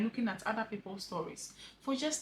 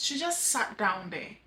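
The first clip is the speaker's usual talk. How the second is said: ordinary talk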